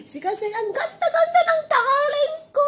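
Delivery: high squealing gush with laughter, near tears. Real, not acted. Owner gushing to her pretty dog, high squeal with laughter.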